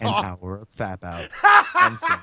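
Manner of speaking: laughing out the punchline; cracking up mid sentence. Real, not acted. Laughing through his own punchline.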